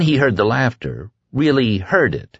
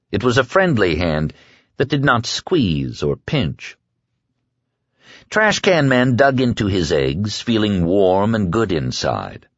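ordinary delivery, nothing marked

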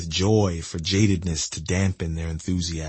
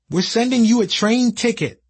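cheery caller; 13 semitones higher, 5 dB louder than usual. Retold or imitated. Brig voiced on phone.

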